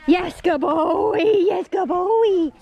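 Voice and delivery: high, slow, drawn-out dog praise; squeaky praising a dog. Real, not acted. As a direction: gush over the dog in a squeaky voice.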